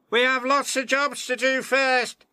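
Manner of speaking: high, small engine voice; a busy little engine pleading; peeping Percy. Acted. Percy telling James about their jobs, high peep. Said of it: "peeped Percy".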